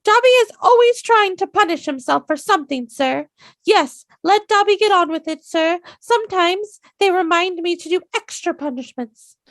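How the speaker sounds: squeaky very high elf voice, far too high; high-pitched Dobby. Retold or imitated. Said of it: "A little bit too high-pitched".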